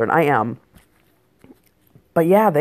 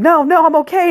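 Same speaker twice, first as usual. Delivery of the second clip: put-on high, louder voice of a child backing away; panicked kid refusal; 13 semitones higher, 6 dB louder than usual. Eight-year-old refusing his dad's help up, high panicked protest. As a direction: yelp it high, fending off help.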